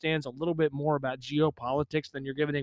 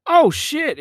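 sudden high loud exclaim; startled outburst; surprised; 11 semitones higher, 11 dB louder than usual. Real, not acted. Startled by microphone knob.

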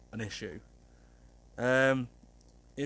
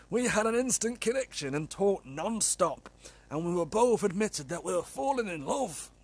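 higher strained character voice reading the letter; put-on letter-writer voice; 5 semitones higher than usual. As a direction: strain your throat into a higher persona. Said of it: "I can't do that for very long, it's killing me throat".

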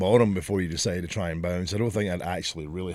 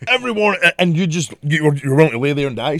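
loud, raised-pitch rant as the nurse; imitated angry telling-off; aggressive shouting; 7 semitones higher, 9 dB louder, faster than usual. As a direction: keep scolding, stumbling over the words. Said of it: "she fucking shouted at me".

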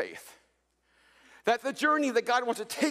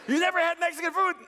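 louder, higher, incredulous owner; stronger disbelief; 6 semitones higher, 3 dB louder than usual. Retold or imitated. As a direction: ask again in disbelief. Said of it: "They said".

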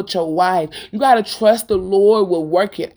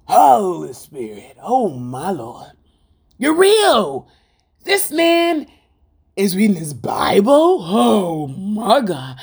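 slow, hushed, overcome with awe; breaking into a cry of wonder at the end; awed praise; slower than usual. Retold or imitated. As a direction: murmur it like you can't believe the blessing. Wife voicing awed praise at husband.

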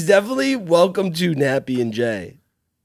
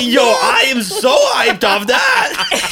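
hyped shout; 8 semitones higher, much slower than usual. Real, not acted. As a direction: yell it out pumped up. Reacting to the chant.

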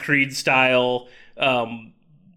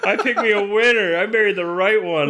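high exclamation easing into sarcasm; hammed-up, higher-pitched sarcastic praise; mock sarcastic gratitude; 5 semitones higher than usual. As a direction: playfully thank the teasing wife. Mock reply to teasing wife.

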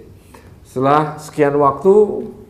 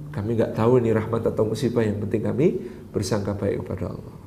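calm pious reply; 5 semitones lower, 7 dB quieter than usual. Acted. Pious father answers calmly.

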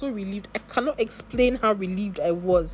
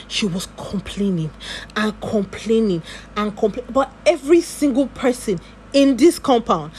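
louder, slowing, fed-up complaining; exasperated rant; 7 dB louder than usual. Real, not acted. About the complaining neighbour, a loud fed-up rant. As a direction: let the annoyance swell out.

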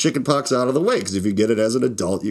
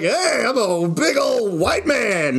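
much higher, slow, hammed-up boast; slow mock self-parody voice; 10 semitones higher, much slower than usual. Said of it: "just be like".

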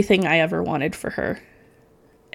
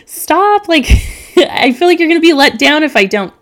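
high, louder mock protest from a friend; imitated laughing protest; 9 semitones higher, 5 dB louder than usual. Friend begging her to stop pushing for tears, mock exasperation. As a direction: protest through a laugh, pitched way up.

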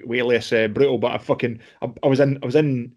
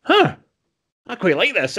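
surprised delight; 9 semitones higher, 3 dB louder than usual. Retold or imitated. Boxer surprised by liking it.

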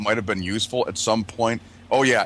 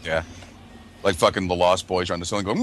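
ordinary delivery

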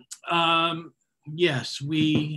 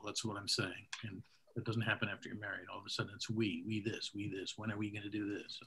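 much softer aside on the mic; muttered gripe; 4 semitones lower, 12 dB quieter than usual. Real, not acted. Host griping about marriage into the mic, much softer. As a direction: grumble it half to yourself.

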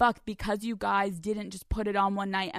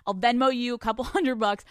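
ordinary talk, nothing marked

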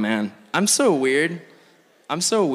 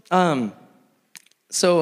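normal talking voice

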